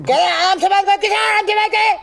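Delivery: high-pitched, indignant retort; angry outburst. Acted. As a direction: throw the demand back in high-pitched outrage. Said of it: "अपना गुस्सा क्यों उतार रहे हैं". (ग़ुस्से में ऊँची आवाज़ में पलटवार)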